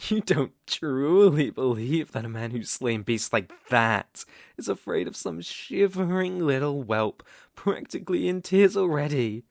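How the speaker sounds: ordinary talk, nothing marked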